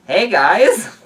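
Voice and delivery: loud, high cheery greeting; calling out. Acted. New mother greeting visiting friends, calling out. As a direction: call out a big hello to the group.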